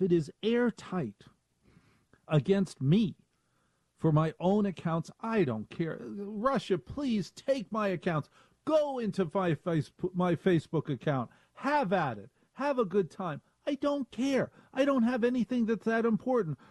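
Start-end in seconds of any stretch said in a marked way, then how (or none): 6.0-16.6 mock carefree offer. Mock offer to Russian hackers.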